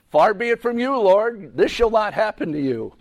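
high, loud protest to the Lord; acted rebuke. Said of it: "began to rebuke him".

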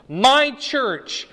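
MY pitched high and loud; stress on MY. Real, not acted. Preacher correcting a misreading of the verse, stress on MY. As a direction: punch MY, drawing it out before church. Contrasting my church with churches.

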